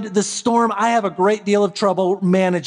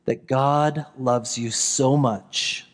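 gentle sermon close; 9 semitones lower, 5 dB quieter than usual. Acted. Pastor inviting the congregation on Christmas Eve, soft and lower. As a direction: invite them quietly to peace.

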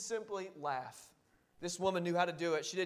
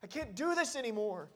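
mock crying out; 4 semitones higher, 5 dB quieter than usual. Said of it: "crying out".

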